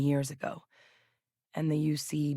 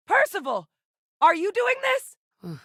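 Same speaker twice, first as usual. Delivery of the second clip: high, alarmed yell, drawn out at the end; high, slow-stretched shouted demand; urgent shouted call. Acted. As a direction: shout the question across the chaos. Calling to Percival amid action.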